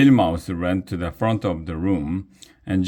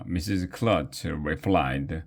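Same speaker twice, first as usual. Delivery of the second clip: usual speaking voice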